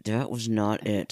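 slow, tight words squeezed through clenched teeth; talking through his teeth. Retold or imitated. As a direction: grit it out through teeth, holding back. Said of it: "kinda talking through his teeth".

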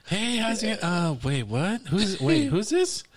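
high-pitched mimicry, growing bewildered; confused caller imitation. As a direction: imitate her faltering as she doubts who answered. Acting confused woman on the phone.

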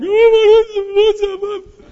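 mock panicked falsetto; a grown man hamming up his own shock in falsetto; high squeaky panic. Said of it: "I'm like".

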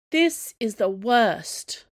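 dragged-out moan of a bored complainer; groaned complaint. A narrator's reading. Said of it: "groaned Finn".

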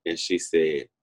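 ordinary delivery

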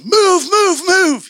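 high, loud shout to keep people moving; imitated yelling. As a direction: yell it urgently, stretching each word. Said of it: "And they're yelling".

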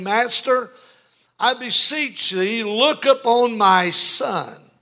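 high, drawn-out pleading cry; father crying out. Acted. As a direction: call out slowly, begging for your son. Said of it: "cried out".

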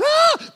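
high sharp "ah" yelp; shocked yelp. Retold or imitated. Preacher recalling the balance, high yelp. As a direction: squeal the shock out. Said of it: "I didn't know it was".

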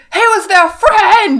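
high, loud, anguished cry of betrayal; outraged cry. Retold or imitated. Podcaster voicing a furious Harry, crying out. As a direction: wail it like a betrayed friend.

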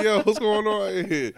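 high, loud baffled question; loud disbelief. Retold or imitated. Son loudly questioning his mom about the phone. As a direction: shout it out, baffled.

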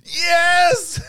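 high delighted shout of yes; excited shout. Real, not acted. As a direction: cheer it out loud. Delighted reaction to plan.

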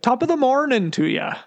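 lilting stage Irish greeting; Irish accent. Pretend. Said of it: "Rolodex of extremely good and accurate, accents".

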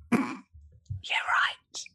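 breathy, scornful whispered aside; sarcastic whisper. Retold or imitated. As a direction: whisper it behind someone's back, doubting. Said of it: "behind your back they were going".

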